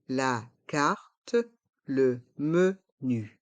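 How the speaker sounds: "La carte, le menu" in slow separate syllables; slow model pronunciation. Shown as a demonstration. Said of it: "Prononçons".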